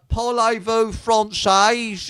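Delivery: put-on slow, loud Cockney-accented French; mock Cockney tourist asking in French. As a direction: drag out the French in broad Cockney. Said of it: "It's London French".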